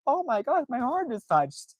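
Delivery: swoon, hammed-up heart-melting; mock touched. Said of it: "angry to being just like".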